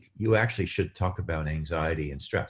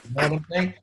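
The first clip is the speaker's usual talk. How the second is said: normal talking voice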